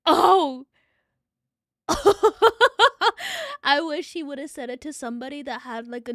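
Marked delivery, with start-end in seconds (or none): 1.9-3.6 big burst of high laughter; loud laughter. Real, not acted. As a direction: crack up loudly.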